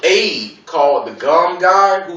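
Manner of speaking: ordinary talk, nothing marked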